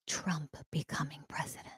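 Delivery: gasp, then whisper it softly; breathless whisper. Real, not acted. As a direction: whisper it like a thrilling secret. Gasped reveal of good news.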